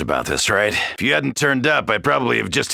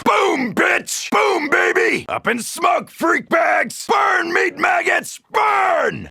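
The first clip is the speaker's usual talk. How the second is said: triumphant battle scream; gloating shout; slower than usual. Acted. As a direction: roar it as things blow up. Gloating over explosive kills.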